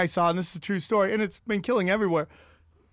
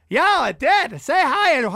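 higher, louder mock old man eager on phone; Vin Scully faking a phone call; 6 semitones higher, 5 dB louder than usual. Old announcer fakes a call to escape his wife, eager. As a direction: ham up an old man pretending someone called.

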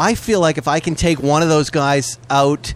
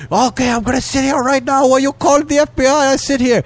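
very high, put-on caller voice; high mock rant; imitated scam caller; 10 semitones higher than usual. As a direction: mimic an indignant phone scammer, pitched way up. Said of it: "And the guy goes".

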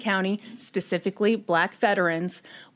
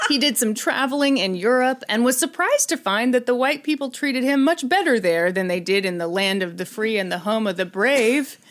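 ordinary talk, nothing marked

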